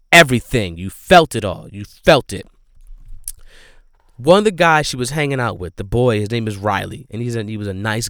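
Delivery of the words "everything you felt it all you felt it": louder, leaning hard on each "felt"; loud, earnest insistence; emphatic. Real, not acted. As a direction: punch the feeling home. Stressing how intense the scene was.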